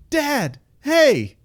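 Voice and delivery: high, loud call across a hallway; loud cheery hail. Retold or imitated. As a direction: yell it out high and loud to get his attention. Calling out to dad in hallway.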